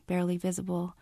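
plain reading voice

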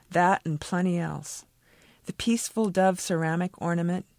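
usual speaking voice

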